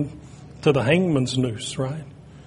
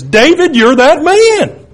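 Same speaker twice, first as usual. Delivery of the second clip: much higher and louder, slow on that man; emphatic accusation; 12 semitones higher, 12 dB louder, slower than usual. Retold or imitated. Prophet Nathan confronting King David, loud emphatic accusation. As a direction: point the finger and hit it hard. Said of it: "with just as much emphasis".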